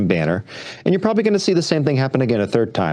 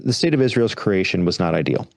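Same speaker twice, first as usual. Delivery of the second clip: ordinary delivery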